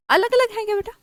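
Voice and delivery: sing-song teacher check; teasing quiz. Real, not acted. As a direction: tease the class for an answer. Teacher quizzing class, teasing.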